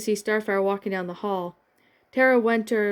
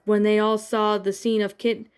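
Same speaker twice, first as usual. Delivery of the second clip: ordinary delivery, nothing marked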